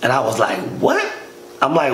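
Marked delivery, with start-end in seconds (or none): 0.8-1.1 sharp, high shocked yelp; a jolt of disbelief, pitched way up; retelling his own stunned reaction; shocked what. Retold or imitated. Shocked reply to Ray's confession.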